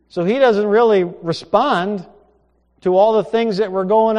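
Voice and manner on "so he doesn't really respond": ordinary delivery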